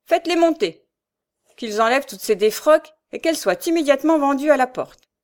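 high, loud commanding voice; peremptory order. A narrator's reading. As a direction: order the men in with authority. Notary orders the furniture sold. (ordre sec et autoritaire, voix forte)

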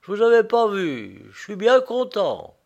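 glad exclaiming. A narrator's reading. Prisoner greeting the friend who helped him, glad. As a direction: sound truly happy to see him. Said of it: "s'écria-t-il".

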